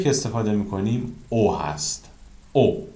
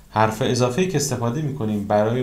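normal talking voice

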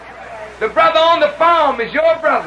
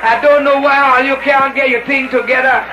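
imitated West Indian brother, pitched down; West Indian accent; 4 semitones lower than usual. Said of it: "the brother from the West Indies".

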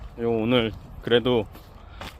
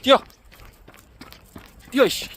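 yelling from the sidelines; 11 semitones higher than usual. Real, not acted. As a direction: shout at the dogs to run. Running with dogs.

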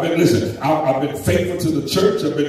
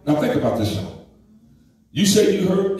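ordinary delivery

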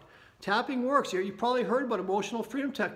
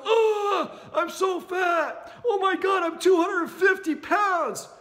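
higher, loud, slow despairing groan; mock despair; 8 semitones higher, 5 dB louder, much slower than usual. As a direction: wail at the scale in disgust. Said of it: "step on that scale and go".

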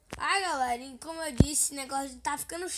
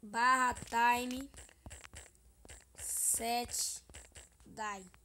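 slow, spelled-out command; slow demonstration; much slower than usual. Shown as a demonstration. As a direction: dictate it slowly. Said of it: "Quem não sabe aí como bota".

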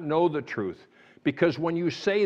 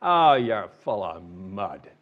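dad's gruff dismissal; 8 semitones lower, slower than usual. Retold or imitated. Preacher imitating his father dismissing the children, low and slow. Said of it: "and he'd say".

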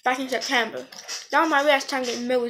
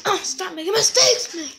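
higher, louder laughing scold; laughing at himself; 6 semitones higher, 3 dB louder than usual. Real, not acted. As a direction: laugh off your own flub. Laughs at own slip.

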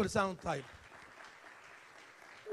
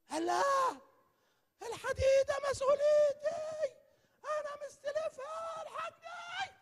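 very high, slow desperate cry; frantic scream; slower than usual. Retold or imitated. Preacher voicing the borrower screaming for help, very high. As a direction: scream like everything depends on it. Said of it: "صرخ".

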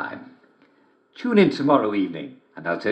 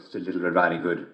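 usual speaking voice

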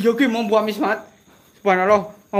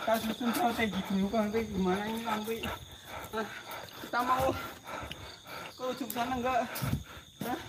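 hushed voice; 12 dB quieter than usual. Real, not acted. Whispering plans in haunted place.

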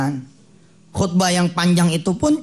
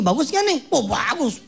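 salesman's patter; 8 semitones higher than usual. Acted. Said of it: "ngomongnya kayak gitu".

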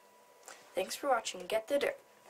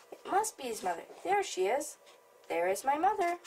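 higher, anxious little bird asking where mother is; a worried baby bird, pitched up; 7 semitones higher than usual. Acted. Baby bird spots the big thing.

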